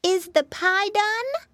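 high squeaky little pig; a small piglet asking; piggy boy voice. A narrator's reading. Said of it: "Flop asked".

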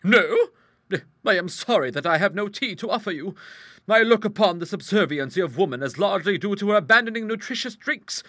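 high, loud, assured character voice; big-voiced matter-of-fact lady; strong-willed hostess. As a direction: explain it heartily and without concern. Hostess lectures visitors.

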